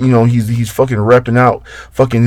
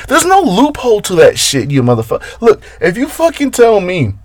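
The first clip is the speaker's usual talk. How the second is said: much higher, heated outburst over nothing; getting really worked up; 10 semitones higher than usual. Real, not acted. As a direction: let the real irritation boil over. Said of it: "I'm getting upset over dumb shit again".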